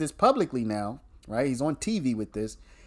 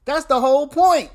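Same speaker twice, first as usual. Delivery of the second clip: higher and louder, driving it home; emphatic loud repeat; 10 semitones higher, 8 dB louder than usual. Real, not acted. Commentator repeating his point to viewers, loud and emphatic. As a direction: hammer the point a second time.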